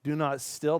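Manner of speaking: ordinary delivery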